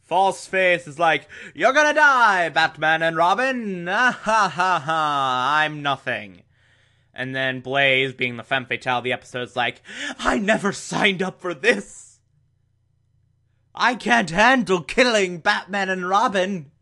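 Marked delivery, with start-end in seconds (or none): 1.5-6.4 hammed-up villain taunt with fake laugh; cartoonish gloating that sags at the end; mock villain gloating. 10.2-16.7 higher-pitched imitation of a distressed femme fatale; lightly hammed-up, higher-voiced protest; mock femme fatale.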